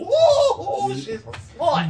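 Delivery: high, loud, laughing mock gasp of outrage; shocked prude. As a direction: play a scandalized old-timer, hammed up. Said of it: "everyone would be like".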